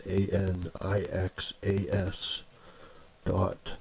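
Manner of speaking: slow, letter-by-letter dictation; slow spelling-out. Real, not acted. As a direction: spell it out slowly for listeners to copy. Spelling out web address for listeners.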